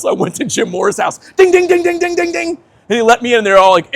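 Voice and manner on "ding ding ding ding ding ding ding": doorbell imitation. Skater ringing at Jim Moore's door, mock doorbell chime.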